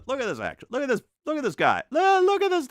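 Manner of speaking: loud, high and gleeful; excited shouting. Real, not acted. Painter cracking himself up over his painting, shouting high. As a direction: show it off, delighted.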